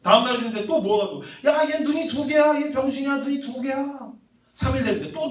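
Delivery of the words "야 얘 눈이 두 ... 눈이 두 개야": jeering mockery. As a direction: tease like a mob of monkeys. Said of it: "막 놀리는 거야".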